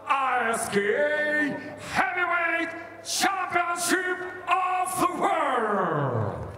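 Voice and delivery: slow, stretched title call over music; arena announcer. Real, not acted. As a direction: draw out the championship title.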